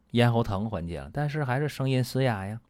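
ordinary talk, nothing marked

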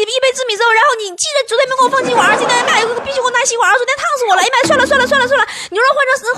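high-pitched, breakneck rush of words; frantic shouted order. Acted. Hurried customer shouts to the bun seller, frantic and fast. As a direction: rattle off the order in a mounting panic. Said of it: "大声喊道".

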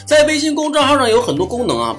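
normal talking voice

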